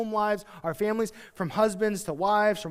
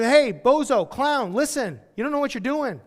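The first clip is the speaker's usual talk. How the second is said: higher, louder mock insult; rude insult; 6 semitones higher, 6 dB louder than usual. Pretend servant insulting his master, mock scornful. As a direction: sneer it like a cocky servant. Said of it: "This servant".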